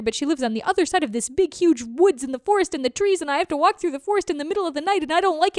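fast tumbling words without pause; rushed rambling. Acted. Turtle rushing his plea to the birds, fast. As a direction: pour it all out in one breath.

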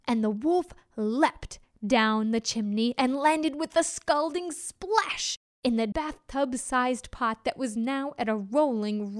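ordinary delivery, nothing marked

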